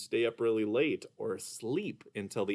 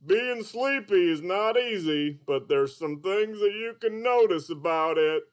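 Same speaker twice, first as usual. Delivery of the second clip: Mister Dolphin puppet; 7 semitones higher, 4 dB louder than usual. Acted. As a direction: play a sleepy, sing-song puppet. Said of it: "Mister Dolphin".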